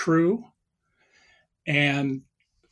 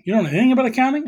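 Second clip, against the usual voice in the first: quick, higher-pitched disbelieving question; incredulous questioner; 7 semitones higher, 3 dB louder, much faster than usual. Retold or imitated. Lawyer questioning Trump under oath, acted incredulous. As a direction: rattle it back, pitched up, as an astonished question.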